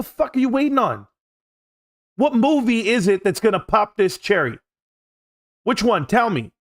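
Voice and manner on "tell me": normal talking voice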